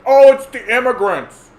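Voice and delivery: high, loud scapegoating voice; mock blame. Mocking voters who blame immigrants, high and loud. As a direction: blurt the excuse like a dim bigot. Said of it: "what are they gonna say".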